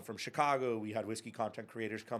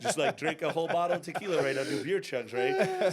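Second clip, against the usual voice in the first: laughing; 5 semitones higher, 7 dB louder than usual. Real, not acted. Laughing about drinking a whole bottle.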